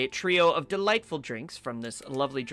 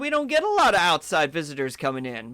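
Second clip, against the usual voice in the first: higher and louder, chirpy shopkeeper welcome; high jolly vendor welcoming a customer; cheery elf snack vendor; 6 semitones higher, 4 dB louder than usual. Acted. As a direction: greet the stranger like a jolly festive elf.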